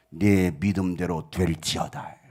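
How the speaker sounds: low, slow, solemn decree; solemn pronouncement. Acted. Jesus pronouncing healing to the sick, solemn.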